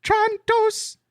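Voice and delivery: mock Greek accent, high pitched; hammy high Greek accent. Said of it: "how does one do a Greek accent?".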